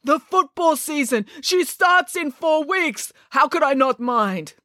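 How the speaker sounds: put-on high, exasperated amateur Russian accent; bad Russian accent. A narrator's reading. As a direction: protest in despair with a Russian accent. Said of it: "I'm very bad at Russian accents".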